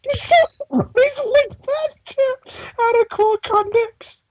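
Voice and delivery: high voice cracking with laughter; laughing. Real, not acted. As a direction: talk through tears of laughter. Laughing hard while talking.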